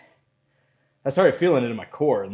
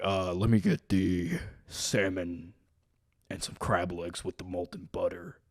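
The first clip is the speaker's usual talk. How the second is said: low, slow imitation of a precocious eleven-year-old; deep-voiced boy; 6 semitones lower, 7 dB quieter than usual. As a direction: order dinner in a put-on manly voice. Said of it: "I talked like this at eleven".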